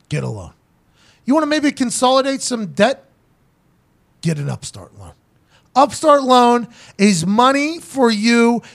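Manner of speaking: upbeat podcast ad read. Acted. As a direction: sell it like a host ad. Reads a sponsor ad.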